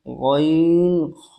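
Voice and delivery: গইন খ stretched very slowly, rasped from the back of the throat; very slow gh and kh from the throat's end; slow Arabic letter demo; drawn-out Arabic letters. Shown as a demonstration. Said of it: "শেষ ভাগ হইতে উচ্চারণ হয়".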